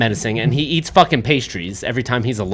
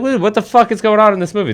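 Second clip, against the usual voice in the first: high-pitched baffled outburst; incredulous, voice jumping up high; exasperated disbelief; 10 semitones higher, 3 dB louder, faster than usual. Retold or imitated. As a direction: react in mock disbelief at the absurdity. Acted exasperated reaction to the plot.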